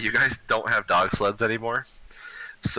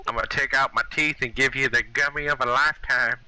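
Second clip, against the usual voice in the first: put-on higher, gummy old granny; old grandma voice; 6 semitones higher than usual. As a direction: threaten like a toothless grandma. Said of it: "who are you, my grandmother?".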